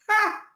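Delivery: sharp high scornful "Ha!"; one jeering bark of a laugh; ex-wife scoffing at his weight; mocking laugh.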